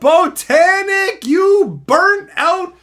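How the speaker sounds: loud, slow, drawn-out mock insult; hammed-up fake rage, loud and slow; mock roast. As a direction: roast him like a cartoon villain. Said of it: "Insult complete".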